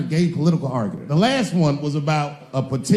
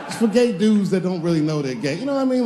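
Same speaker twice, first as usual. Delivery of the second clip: ordinary delivery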